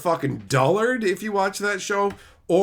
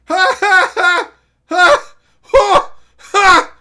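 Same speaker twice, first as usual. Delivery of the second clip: loud high canned laughter; mock laugh-track burst; fake laugh track; 13 dB louder, much slower than usual. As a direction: roar like a studio audience.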